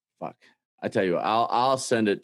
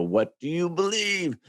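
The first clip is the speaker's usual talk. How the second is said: quoted intro; 8 semitones higher than usual. Retold or imitated. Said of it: "He had a really good intro".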